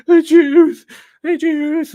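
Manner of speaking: high, loud, drawn-out mock cry; dying character's cry. Streamer mocking a Disney death scene, high hammed-up cry.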